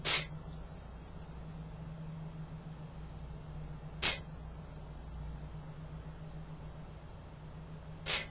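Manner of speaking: "T" with a strong puff of air after it; aspirated t. Shown as a demonstration. Teacher drilling students on the aspirated t. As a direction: drill t, breath pushed out. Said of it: "First, the T".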